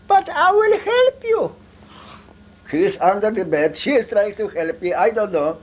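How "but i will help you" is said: scolding grandmother. Retold or imitated. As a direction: squeak it out like a fussing grandma. Grandmother voiced in retold story.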